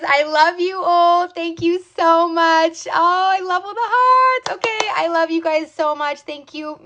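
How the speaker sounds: high, drawn-out emotional gushing; real high-pitched, overcome sign-off; gushing thanks. Real, not acted. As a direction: gush love at the viewers, pitched high.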